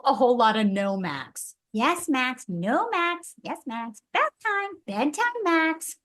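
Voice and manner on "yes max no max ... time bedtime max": high, scolding big-sister singsong; Ruby voice. Pretend. Voice actress doing Ruby, high scolding Max. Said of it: "Ruby was a lot of whole".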